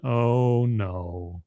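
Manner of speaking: deflated, falling realization; sinking dismay. Acted. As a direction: let it drop away in dread. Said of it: "And I'm like".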